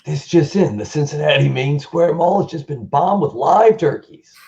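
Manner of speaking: high, announcing news flash like a newscaster; eighties reporter voice. Pretend. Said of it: "eighties movie kind of reporter voice".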